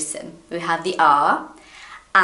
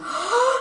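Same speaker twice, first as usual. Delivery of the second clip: mock gasp. Imagining the loop.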